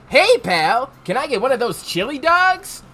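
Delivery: perky and brash, a bit silly; Sonic. Acted. Hungry customer asking staff for chili dogs, goofy. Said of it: "Should I do my goof-ass Sonic voice?".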